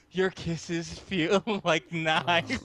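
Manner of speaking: slow, drawn-out delivery; slow line broken by a laugh; drugged rapture. Acted. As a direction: stretch the words out dreamily. Drugged devotee speaks while collapsing.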